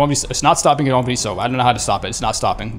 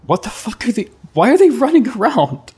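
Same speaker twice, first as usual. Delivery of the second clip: high, incredulous outburst; baffled exclamation; 10 semitones higher, 3 dB louder than usual. Real, not acted. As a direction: blurt it out high in disbelief. Startled by enemies running around.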